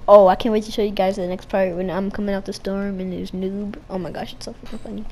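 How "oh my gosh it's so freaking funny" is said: usual speaking voice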